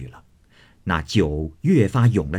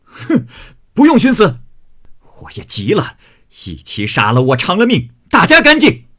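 furious snort, bursts of very high, loud shouting; enraged threat; 9 semitones higher, 8 dB louder than usual. Acted. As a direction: threaten wildly, exploding on the final words. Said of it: "贾琏气得墙上拔出剑来". (暴怒威胁，高声吼叫)

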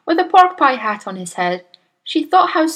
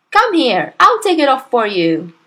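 loud, raised, take-charge wife; bossy insistence; 4 semitones higher, 6 dB louder than usual. A narrator's reading. Wife offering to pull the hat off, bossy. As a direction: order him over, louder and higher.

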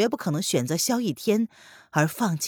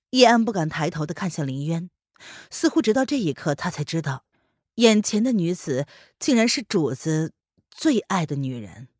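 usual speaking voice

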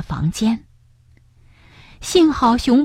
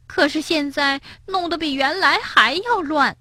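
high-pitched, mildly rueful confession; ashamed; 8 semitones higher, 4 dB quieter than usual. A narrator's reading. Bengbeng confessing to granny, ashamed. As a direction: admit it sheepishly in a high voice. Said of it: "蹦蹦和跳跳羞愧地低下头".

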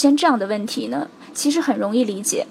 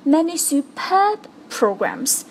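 superb said slowly and held; stress on superb; 4 semitones higher, slower than usual. Shown as a demonstration. 老师示范重读superb. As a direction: lean on superb. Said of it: "把这两个词重读出来".

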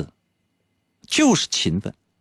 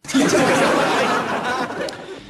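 high, breathy burst of laughter; laughing. Real, not acted. Host laughing at his own teasing, real laugh. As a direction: laugh at your own joke.

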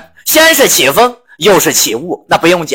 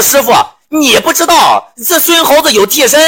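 shouting; 9 semitones higher than usual. Acted. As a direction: yell it out to tell on him. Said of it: "开始在那嚷".

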